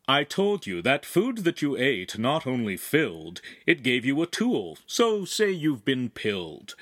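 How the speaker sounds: bouncy sing-song recitation; rhyming verse. Acted. Explaining the magic food to the teens in playful verse. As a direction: lilt it like a nursery rhyme.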